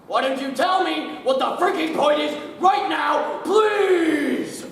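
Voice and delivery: high and loud, laughing at the end; angry shout. Acted. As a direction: yell the question at him. Boss yelling at employee.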